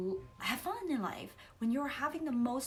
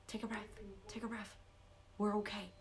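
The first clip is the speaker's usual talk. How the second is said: soft, soothing coaching; calming reminder; 3 semitones lower, 8 dB quieter, faster than usual. Retold or imitated. Boyfriend reminding a charged-up partner to breathe, soft and calming. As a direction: talk them down softly.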